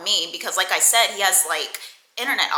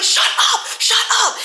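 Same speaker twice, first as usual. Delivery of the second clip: high, loud, repeated outburst; mock pissed-off yell; 13 semitones higher, 6 dB louder than usual. Man cutting off woman on the phone, mock pissed-off yell. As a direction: imitate a furious man yelling over her. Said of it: "he's so fucking pissed".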